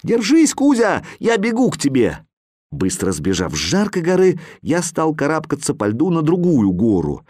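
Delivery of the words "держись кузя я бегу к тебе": shouting. A narrator's reading. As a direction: shout encouragement across the ice. Boy shouts to freezing cat.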